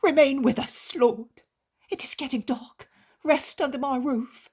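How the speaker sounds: high, loud, desperate begging; pleading innkeeper. Acted. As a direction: beg the Lord on your knees for your child. Innkeeper invites the Lord.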